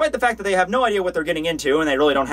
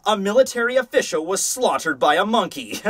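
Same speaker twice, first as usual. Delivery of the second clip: higher, theatrical mock-proclaiming voice; taunting comeback; 4 semitones higher than usual. Boy taunting the officer with his own insult, theatrical comeback. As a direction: proclaim it like a gloating headline. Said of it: "Really good comeback on Basby's part here".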